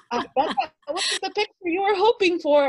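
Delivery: high, loud and laughing; laughing quip. Retold or imitated. Runner joking about the cotton candy, laughing. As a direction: tease through laughter. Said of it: "I was like".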